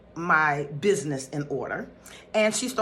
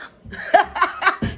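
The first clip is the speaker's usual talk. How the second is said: high breathy laugh; 13 semitones higher, 7 dB louder than usual. Real, not acted. Laughing at her own joke.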